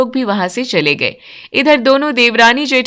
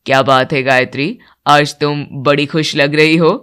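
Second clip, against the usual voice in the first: much lower, steady male voice; older man; 8 semitones lower than usual. Acted. Father and son praising the bride's cooking, much lower voice. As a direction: speak as the elder men of the house. Husband asks elder wife.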